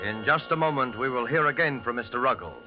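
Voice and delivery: normal talking voice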